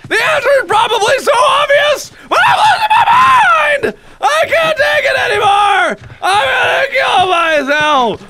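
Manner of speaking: high-pitched hollering meltdown; melodramatic frustrated wailing. Real, not acted. Player who hates being lost, melodramatic high wailing. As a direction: wail it like a hammed-up breakdown.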